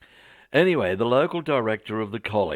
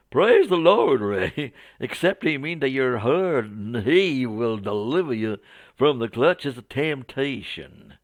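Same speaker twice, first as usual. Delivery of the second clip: mock Southern revival preacher, loud at the start; hammed-up Southern American; Southern American accent; 3 semitones higher, 4 dB louder than usual. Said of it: "my poor attempt at a Southern American accent".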